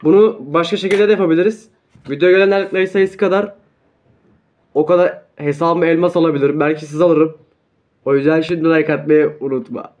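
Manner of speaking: loud hammed-up commercial voice over music; mock ad read. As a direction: pitch it like a cheesy sponsor break. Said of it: "ben sizi bir reklamlara gönderip geliyorum".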